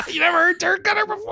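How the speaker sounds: high, cracking laughing shout; laughing disbelief. Real, not acted. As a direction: squeal it through laughter.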